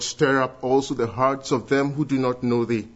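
ordinary talk

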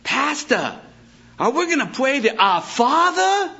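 high, loud mock parishioner; church lady. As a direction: pitch it way up like a fretting lady. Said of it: "One lady came to us and said".

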